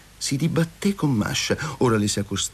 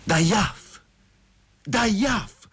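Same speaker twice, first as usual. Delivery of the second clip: higher-pitched indignant cry; agitated exclamation; 10 semitones higher, much slower than usual. Acted. Chertopkhanov confronts Masha leaving him, agitated outcry.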